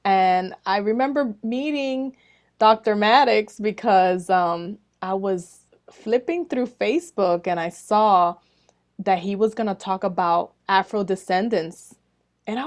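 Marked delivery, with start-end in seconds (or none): none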